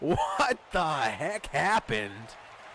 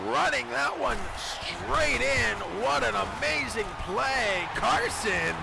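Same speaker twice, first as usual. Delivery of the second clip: excited; 6 semitones higher than usual. Acted. Excited aftermath of touchdown.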